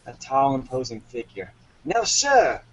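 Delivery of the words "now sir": high, sharply falling command; captain's cry. Acted. Captain addressing the misbehaving wombat, high commanding cry. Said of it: "cried the captain".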